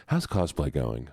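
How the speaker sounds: usual speaking voice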